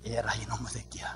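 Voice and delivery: exasperated whispered curse; whispered scolding. Pretend. Father whispering at overly formal son, exasperated. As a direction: hiss it out under your breath.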